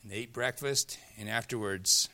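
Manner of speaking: ordinary talk, nothing marked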